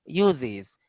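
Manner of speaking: "ইউজেস" as "yu-ziz"; iz ending. Shown as a demonstration. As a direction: buzz both z sounds in "ইউজেস". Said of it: "Joy রসিকার জি".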